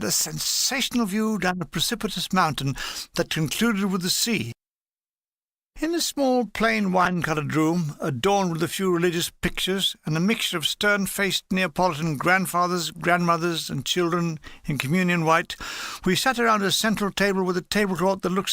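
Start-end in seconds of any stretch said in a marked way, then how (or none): none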